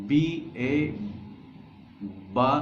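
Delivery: बी, ए, then बा, each letter said apart, then blended; sounding out syllables. Shown as a demonstration. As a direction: spell the letters out slowly, then join them as बा. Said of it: "जैसे".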